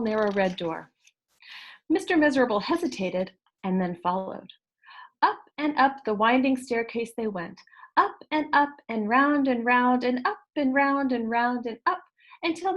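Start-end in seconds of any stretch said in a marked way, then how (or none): none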